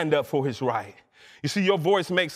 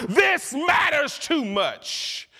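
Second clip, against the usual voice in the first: high, drawn-out preacher's shout; impassioned cry; 8 semitones higher, much slower than usual. Real, not acted. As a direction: hold out every word at full fervour. Climax of impassioned protest.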